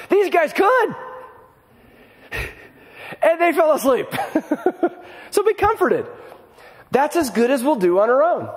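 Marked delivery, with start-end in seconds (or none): 0.1-6.1 much higher, laughing through the words; amused disbelief that they fell asleep. Real, not acted. Laughing at the disciples' failure.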